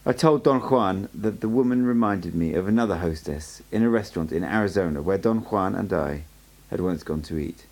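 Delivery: ordinary delivery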